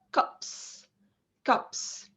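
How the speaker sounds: CUPS cut short, voiceless ps at the end; short clipped vowel. Shown as a demonstration. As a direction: say "cups" quickly with a tiny vowel and a crisp p. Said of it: "say it very quickly".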